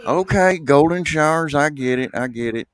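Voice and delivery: high excited to calmer. Acted. Man realizes, backing off.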